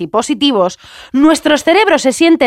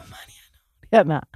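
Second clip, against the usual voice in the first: whispered name; 6 semitones lower, 4 dB quieter than usual. Pretend. Friend acting a confused introduction, ending in a whisper. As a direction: let the name die away in a whisper.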